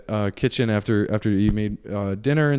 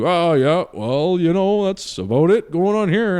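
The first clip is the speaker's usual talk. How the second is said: higher-pitched dad winding up a call; awkward dad; 4 semitones higher, slower than usual. Retold or imitated. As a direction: play a dad stalling on the phone.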